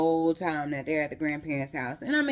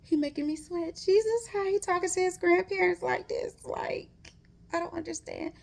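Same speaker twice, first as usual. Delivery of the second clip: high, breathy Lord Jesus plea; exasperated prayer; 13 semitones higher than usual. Retold or imitated. Reader reacting to the book, mock exasperated prayer. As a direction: throw up your hands to heaven. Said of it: "I'm just reading the book and I'm just like".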